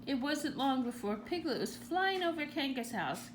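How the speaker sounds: ordinary delivery, nothing marked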